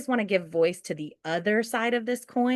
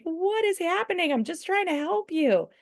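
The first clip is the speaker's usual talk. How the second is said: much higher, baffled and helpless; exasperated disbelief; 12 semitones higher than usual. Retold or imitated. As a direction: throw your hands up, pitched way up. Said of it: "And you're like".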